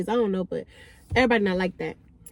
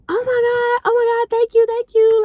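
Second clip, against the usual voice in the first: high, louder gushing thanks; excited; 11 semitones higher, 6 dB louder than usual. Retold or imitated. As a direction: gush with relief and thanks. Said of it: "she was excited about the phone".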